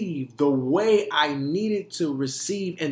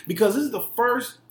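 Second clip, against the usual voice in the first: real hype, raised voice hammering the praise; hyping her up; 6 semitones higher, 8 dB louder than usual. Real, not acted. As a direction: punch each word high and proud. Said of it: "let me hype her up real quick".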